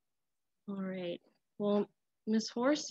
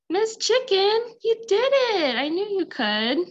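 excited cheer; 6 semitones higher, 5 dB louder than usual. Real, not acted. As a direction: cheer the puppet on. Cheering chicken over the fence.

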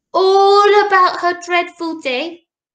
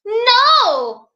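high, loud swooping denial; storybook little girl's big no; little princess; 8 semitones higher, 5 dB louder than usual. A narrator's reading. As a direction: deny it like an indignant child. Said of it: "said the little princess".

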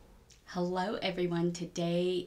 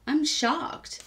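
shocked; 9 semitones higher, 6 dB louder than usual. Real, not acted. Thrilled at her fast progress.

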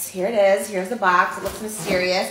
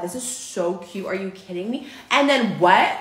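normal talking voice

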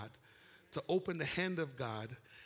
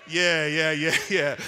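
laughing yeah; 12 dB louder, slower than usual. Real, not acted. Laughing shouted agreement.

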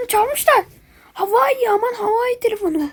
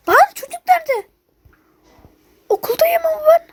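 much higher, whiny yell; mock crying shout; 10 semitones higher, 3 dB louder than usual. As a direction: wail it out in fake despair. Yelling, crying in panic. (çok yüksek perdeden sahte ağlamaklı bağırış)

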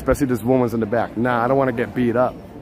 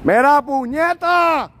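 high, very slow yell; long high Spanish holler; hollered Puerto Rican curse; 12 semitones higher, much slower than usual. Shown as a demonstration. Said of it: "That's Puerto Rican curse right there".

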